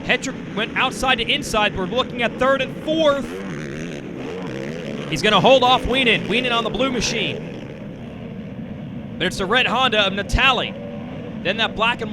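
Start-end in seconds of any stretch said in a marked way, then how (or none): 0.0-7.4 excited live race call; motorsport commentator. Acted. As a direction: call the battle for the lead.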